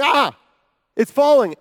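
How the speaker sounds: loud high cry of alarm; panicked crowd scream. Retold or imitated. Crowd below screams as debris falls, loud alarmed cry. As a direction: shout it like the roof is falling in. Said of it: "crowd below start screaming".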